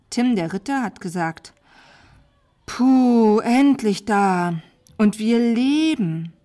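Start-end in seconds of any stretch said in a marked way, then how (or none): 2.7-6.3 loud, drawn-out relief; relieved exhale. A narrator's reading. Knight arriving after days of riding, loudly relieved. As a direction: let the words stretch out with relief.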